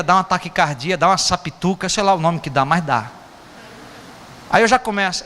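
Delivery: ordinary talk, nothing marked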